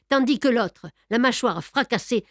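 ordinary delivery, nothing marked

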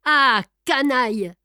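cursing the Englishman; swearing. A narrator's reading. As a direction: spit out the insult while swearing. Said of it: "en jurant".

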